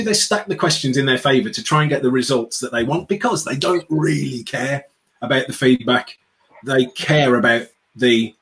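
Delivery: normal talking voice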